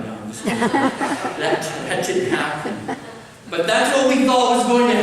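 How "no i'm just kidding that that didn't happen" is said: chuckling through the words; laughing. Real, not acted. Preacher admits he is kidding about lightning, laughing. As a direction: break into a laugh while taking it back.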